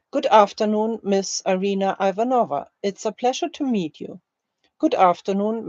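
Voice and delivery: ordinary talk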